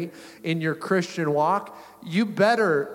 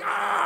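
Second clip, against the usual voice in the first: high-pitched yelp of pain, acted out; mock pain yell. Skier retelling his shoulder tearing, mock pained yelp. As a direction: yelp as if your shoulder just tore.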